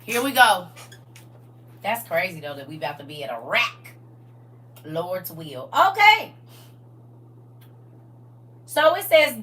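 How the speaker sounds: ordinary delivery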